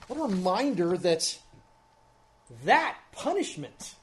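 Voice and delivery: preacher's rising emphasis, pressing the point. Real, not acted. Repeats phrase, then builds it up.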